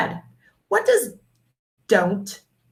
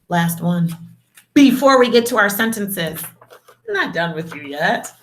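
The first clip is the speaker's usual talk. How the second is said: ordinary talk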